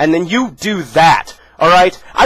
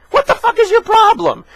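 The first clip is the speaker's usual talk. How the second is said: exasperated outburst. Real, not acted. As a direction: voice leaps far up in outrage. Furious rant at an adversary.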